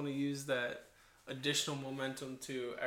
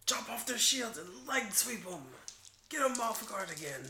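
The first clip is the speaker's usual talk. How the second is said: high-pitched mock rallying cry; goblin battle orders; 8 semitones higher than usual. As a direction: egg the goblins on to attack. Player shouts goblins' battle orders.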